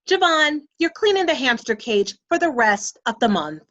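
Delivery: stern mom. Acted. Mother hands down punishment.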